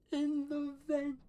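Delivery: very high, stretched-out coaxing words; excited. Acted. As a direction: draw it out high, like an eager secret invitation. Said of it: "He's so excited".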